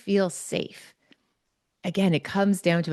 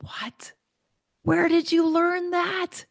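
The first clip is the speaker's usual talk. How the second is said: hushed what, then high, drawn-out disbelief; retold amazement, pitched way up and slow; incredulous; 11 semitones higher, slower than usual. Retold astonishment at Ava's reply.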